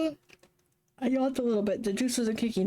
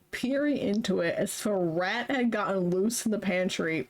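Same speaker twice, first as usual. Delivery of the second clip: usual speaking voice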